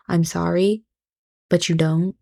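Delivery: ordinary talk, nothing marked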